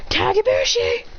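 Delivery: high, strange-voiced move name; attack call. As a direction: yell the technique like a cartoon fighter.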